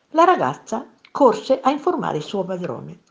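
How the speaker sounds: plain reading voice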